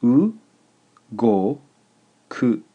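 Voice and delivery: u, go and ku split by pauses; slow dictation, high emphatic u, then go and ku apart; syllable by syllable. Shown as a demonstration. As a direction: dictate u, go, ku one at a time, u pitched high. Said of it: "is three syllables".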